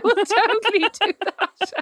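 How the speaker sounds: high, drawn-out words through laughter; laughing agreement. Real, not acted. Sister jumps at a brilliant gift idea, laughing.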